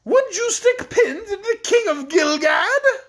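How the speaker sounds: high-pitched, drawn-out outraged horror; horrified cry. A narrator's reading. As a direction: protest aghast as a threatened king. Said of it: "cried Rinkitink in horror".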